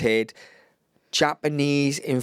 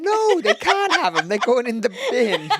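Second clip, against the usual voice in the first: laughing refusal; 11 semitones higher, 4 dB louder than usual. Real, not acted. Jokey refusal, laughing, raised voice.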